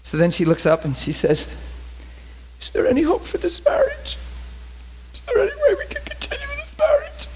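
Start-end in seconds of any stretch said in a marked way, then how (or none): 2.6-7.3 very high, fragile pleading; high-pitched mock-crying plea; retold tearful plea. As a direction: imitate her tearfully asking for hope. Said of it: "gasping for breath and crying".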